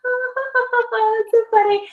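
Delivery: high, laughing refusal. Retold or imitated. Couple refusing the window invitation, laughing and high. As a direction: wave it off while laughing. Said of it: "we're all like".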